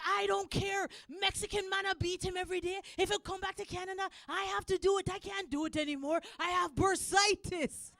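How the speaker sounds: high-pitched Jamaican dad impression; Jamaican accent. Dad refusing to pay for his son, Jamaican accent. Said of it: "This is Jamaican accent".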